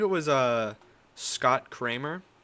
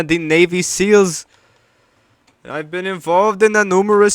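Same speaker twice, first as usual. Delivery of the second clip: put-on loud, shouted Jamaican accent, tough-guy rant; 5 semitones higher, 11 dB louder than usual. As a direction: bark it out in a heavy Jamaican accent. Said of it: "I should do more Jamaican accents".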